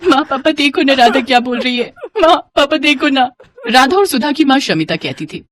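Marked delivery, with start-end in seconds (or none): 0.1-3.3 higher-pitched crying child, acted; tearful complaint. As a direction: whine to the parents in mock tears. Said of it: "ये सुनकर सुधा हमेशा रोती थी".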